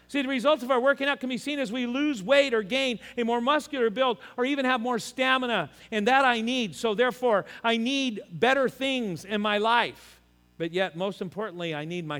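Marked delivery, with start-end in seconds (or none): 0.1-10.1 higher, driving sermon voice; preaching. Acted. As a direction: preach it out with conviction. Preaching raised.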